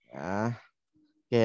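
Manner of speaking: usual speaking voice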